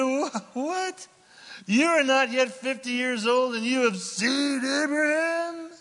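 a high, disbelieving "what?". Acted. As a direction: scoff in shocked disbelief.

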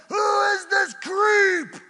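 furious high crying shout; enraged shout. Acted. Preacher voicing King David's rage, furious shout.

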